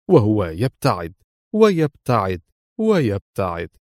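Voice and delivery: usual speaking voice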